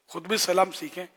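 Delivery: ordinary talk, nothing marked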